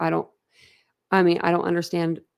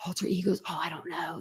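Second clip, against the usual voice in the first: whisper; 2 semitones lower, 11 dB quieter than usual. Real, not acted. Whispered aside reading chat.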